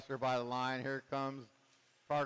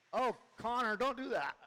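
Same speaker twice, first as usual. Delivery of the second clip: much higher, alarmed yelp at the driver; excited outcry; 11 semitones higher than usual. Real, not acted. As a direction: cry out as the car goes wrong. Driver crashes, caller reacts.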